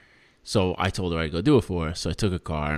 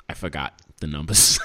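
ordinary talk

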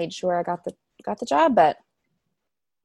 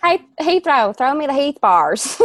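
hammed-up Southern drawl, higher, louder and slow; Southern accent; 7 semitones higher, 8 dB louder than usual. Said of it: "like, in a Southern accent".

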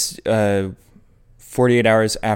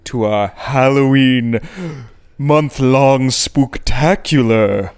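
jack o lantern voice; 4 dB louder, much slower than usual. Acted. Spooky Halloween show opener.

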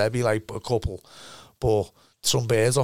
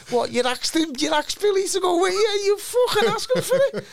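mock disbelief. Teammates mocking him Monday.

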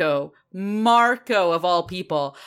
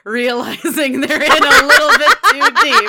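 dramatic realization; 4 semitones higher, 8 dB louder than usual. Acted. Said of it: "acting the italic O".

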